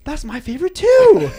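very high squeal rising on TOO; hammed-up amazement, bursting out loud on "too"; feigned delight. Papa Beal buttering up the kid, over-the-top feigned delight.

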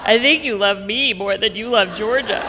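put-on a little girl blurting her fear; lightly voiced upset child; hurt child.

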